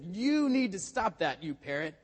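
loud, high, drawn-out exasperated order; acted scolding, stretching out the opening words. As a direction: raise the voice in mock exasperation. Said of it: "Matt was a little exasperated".

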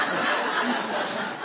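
real unvoiced chuckle at his own joke; preacher laughing at his own church joke, airy chuckle; airy breathy laugh. Real, not acted. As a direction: let a breathy laugh slip out.

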